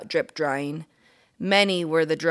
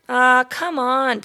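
higher, louder, drawn-out plea; pleading; 7 semitones higher, 8 dB louder, slower than usual. Acted. Ralph the mouse begging Keith for the motorcycle, pleading. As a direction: beg with a stretched ah. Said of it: "pleaded Ralph".

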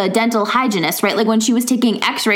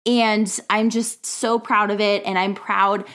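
ad break; 4 dB quieter than usual. Real, not acted. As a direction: softly share the book news, close to the mic. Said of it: "I am so, so excited".

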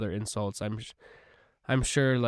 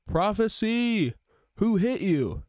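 high, slow jeering taunt; mocking demand; 10 semitones higher, 4 dB louder, slower than usual. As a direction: taunt him like a cruel guard.

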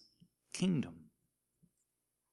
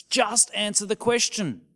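higher, impatient; exasperated demand; 7 semitones higher than usual. Retold or imitated. People to politicians, a mild exasperated demand. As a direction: demand it, fed up. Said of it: "we say".

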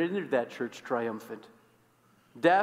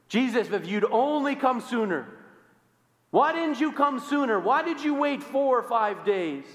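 higher, louder pleading reproach; accusing grief; 8 semitones higher, 3 dB louder than usual. Acted. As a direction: demand why he came late, voice rising. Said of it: "Accusations abound".

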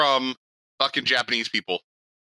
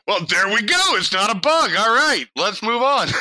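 high, raised-voice throwing in the towel; mock exasperated resignation; 9 semitones higher, 5 dB louder than usual. Retelling giving up on a bug report, loud mock resignation.

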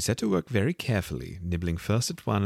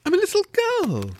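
doubtful; 7 dB louder, faster than usual. A narrator's reading. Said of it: "rather doubtfully".

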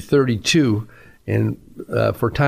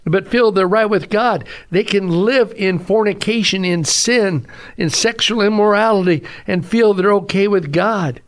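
raised pitch, driving emphatic sermon voice; forceful preaching; 8 semitones higher than usual. Real, not acted. Preacher warning those living in sin, forceful preaching. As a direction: preach it with urgent conviction.

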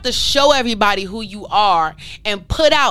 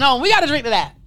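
ordinary delivery

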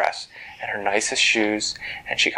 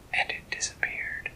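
ordinary delivery

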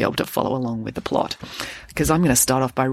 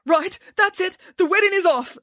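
high-pitched, decisive declaration; announcing. A narrator's reading. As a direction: call the whole thing off. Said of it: "announced Dad".

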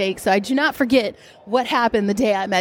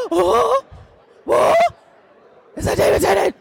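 high, breathless gasps of disbelief; breathless fangirl gasp; 10 semitones higher, 3 dB louder than usual. Real, not acted. Fan spotting her idol across the hall, breathless high gasps. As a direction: gasp as if you just spotted your idol. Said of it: "I was literally hyperventilating".

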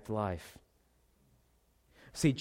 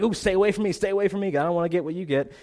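high, louder, playful recoil; mock alarm; 6 semitones higher, 5 dB louder, faster than usual. Imitating someone dodging a sick family member, mock alarm. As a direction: squeal at a sick relative to keep away. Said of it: "You're like".

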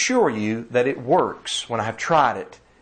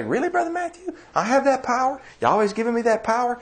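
much higher, incredulous squeaky imitation; mock doubtful listener; 11 semitones higher, faster than usual. Preacher mimics a surprised congregant questioning him, high and incredulous. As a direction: squeak it out like a stunned churchgoer. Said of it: "You say".

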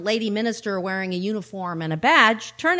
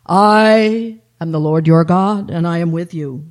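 voice of God; 3 semitones lower, 10 dB louder than usual. Acted. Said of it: "said the voice".

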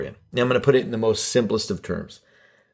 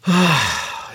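heavy, fed-up sigh; worn-out exhale; exasperated sigh; 3 semitones higher than usual. Pretend. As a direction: let out a long sigh of defeat. Exasperated with imagined Marjorie.

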